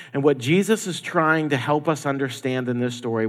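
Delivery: ordinary talk, nothing marked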